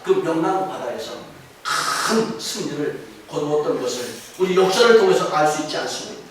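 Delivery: ordinary delivery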